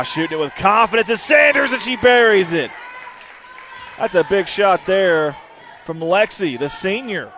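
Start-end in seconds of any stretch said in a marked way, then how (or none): 0.0-2.7 play-by-play excitement, voice climbing; excited scoring call. Real, not acted. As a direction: build to a raised shout as it drops. Calling a made three live.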